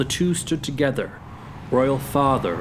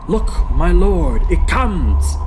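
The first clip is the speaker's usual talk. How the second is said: loud, high cry of fright; trembling alarm; 5 semitones higher, 7 dB louder, slower than usual. Acted. Horatio alerting Hamlet to the ghost, frightened. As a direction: call out in alarm. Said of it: "He trembled in the night".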